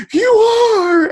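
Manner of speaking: high, loud, cracking accusation; mother shrieking an accusation at her son in the car; sudden shriek. Retold or imitated. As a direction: blurt it out in a shocked shriek. Mom bursts out accusing in car.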